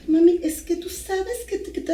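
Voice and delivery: put-on high, teen daughter whining "Mami"; mother retells her teenage daughter explaining herself, high and whiny; daughter pleading. Daughter voiced in story.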